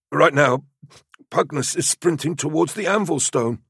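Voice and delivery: high put-on youth voice, mock innocence; insolent youth Clepiades. A narrator's reading. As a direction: report to the sergeant with feigned innocence.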